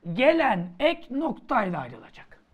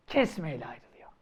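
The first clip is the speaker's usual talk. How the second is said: whisper; 9 semitones lower, 7 dB quieter than usual. Acted. Teacher drops voice on rule.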